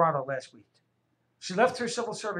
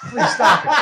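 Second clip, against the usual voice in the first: loud laughing plea; 4 semitones higher, 10 dB louder than usual. Real, not acted. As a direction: beg him to stop, laughing.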